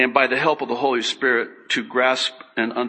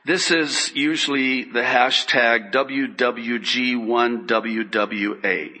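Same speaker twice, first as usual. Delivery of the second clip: ordinary talk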